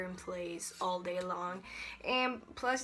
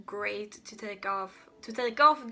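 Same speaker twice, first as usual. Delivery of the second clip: slipping into a Swedish accent; a heavy Swedish accent on take off; 5 dB louder than usual. Real, not acted. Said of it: "That was a really Swedish accent".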